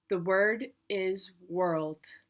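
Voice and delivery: very slow, each word set apart; slow dictation. Retold or imitated. Teacher giving the spelling word, slow and deliberate. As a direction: read it out slowly like a spelling bee caller.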